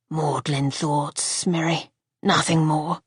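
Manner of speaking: low, slow, weary man's voice; Archer's voice. Acted. Husband deflecting his wife's question, low and slow.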